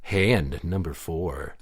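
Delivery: low, slow, deliberate call; slow announcer call. Acted. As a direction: announce the round slowly. Game referee call.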